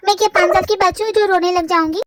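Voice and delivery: usual speaking voice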